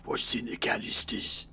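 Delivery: low, whispery gas-mask villain; gruff Batman-like voice. Acted. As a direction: rasp it slowly in a low whisper. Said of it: "with that fucking voice".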